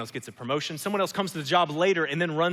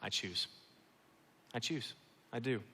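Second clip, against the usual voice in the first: 1 soft voice of God; 3 semitones lower, 9 dB quieter than usual. Acted. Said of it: "Jesus speaking".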